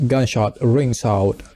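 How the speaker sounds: usual speaking voice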